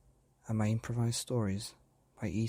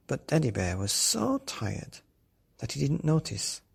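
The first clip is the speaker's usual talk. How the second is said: plain reading voice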